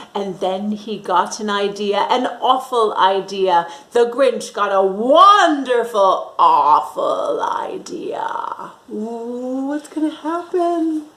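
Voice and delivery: ordinary delivery, nothing marked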